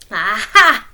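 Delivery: loud triumphant Aha; discovery. Acted. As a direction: crow with discovery. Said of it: "said Anansi".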